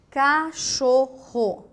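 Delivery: slow and clear. Shown as a demonstration. Teacher reading a word to the class, slow and stretched. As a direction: say Cachorro syllable by syllable. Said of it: "Pensem um pouquinho".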